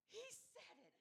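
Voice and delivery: put-on a parent stunned by a baby's first word; delighted gasp. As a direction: catch your breath in happy surprise.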